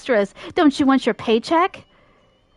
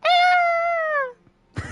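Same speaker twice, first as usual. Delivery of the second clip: high yelp. Acted. Cry of surprise.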